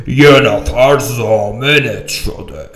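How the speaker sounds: very slow, drawn-out announcement; cop's news. Acted. Cop tells the prisoner someone posted bail, very slow. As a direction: stretch every word.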